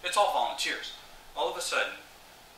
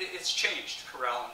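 usual speaking voice

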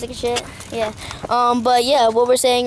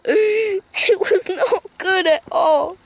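slow, higher hammed-up sob; mock crying; 7 semitones higher, much slower than usual. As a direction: break into fake tears over lost partners. Mock mourning for eaten partners.

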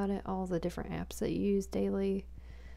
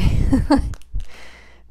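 burst of laughter; 7 semitones higher, 13 dB louder than usual. Real, not acted. Laughs at own poor planning.

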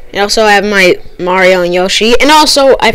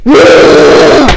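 creepy sound imitation; 6 dB louder than usual. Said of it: "It's like".

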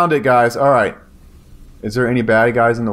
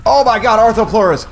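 startled outburst; 8 semitones higher, 3 dB louder than usual. Real, not acted. Sudden giant bugs attack.

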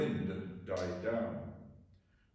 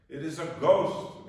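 loud, high frightened cry; 6 semitones higher, 8 dB louder than usual. Acted. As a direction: cry it out in terror. Disciples cry out in fear.

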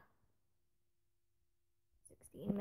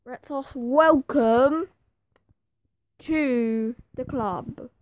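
loud and slow, savouring each word; grand welcome; 11 dB louder, slower than usual. Real, not acted. Gamer welcoming the new signing, loud and slow. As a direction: announce it like a proud chairman.

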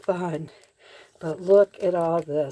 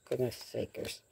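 ordinary talk, nothing marked

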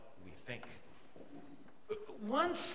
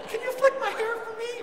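very high, louder girl impression; mock girly voice; 5 dB louder than usual. Preacher mimicking girls at church, mock girly voice. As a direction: squeak it out like a teased girl. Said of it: "G C C girls gonna be".

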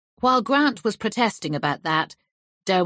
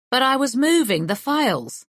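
indignant, raised protest; 7 semitones higher, 4 dB louder than usual. Acted. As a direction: object loudly and indignantly. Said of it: "I protested".

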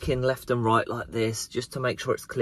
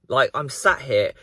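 normal talking voice

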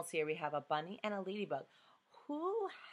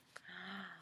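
sharp gasp of surprise; quick surprised intake of breath; excited gasp; 16 dB quieter than usual. Acted. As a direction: gasp before the answer. Gasp before the reveal.

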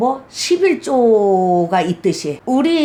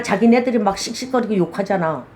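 normal talking voice

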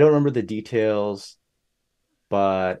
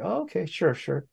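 high, softer, obliging reply; very polite agreement; 9 semitones higher, 4 dB quieter than usual. Retold or imitated. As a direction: imitate someone agreeing politely, light and high. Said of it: "she's very polite about it".

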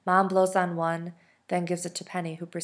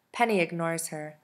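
ordinary delivery

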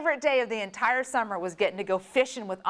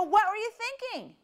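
much higher, outraged demand; alarmed scolding; 12 semitones higher than usual. Retold or imitated. Mother scolding her brother on the phone, high and outraged. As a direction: let him have it on the phone. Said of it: "and I go".

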